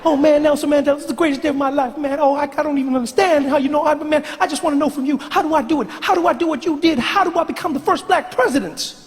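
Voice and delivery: put-on high, rapid, eager young Barack; young Barack begging his mentor, high and fast; high fast young Obama. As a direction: rush the words high and breathless. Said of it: "You are speaking so fast".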